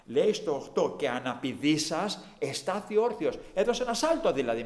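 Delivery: loud preaching burst. Acted. Preacher explaining the lame man leaping, preaching loud. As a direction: build it up with energy.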